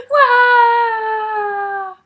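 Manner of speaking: high, long storybook bawl; cartoon child's crying "wah"; loud mock wail. A narrator's reading. Taya crying to her father, wailing.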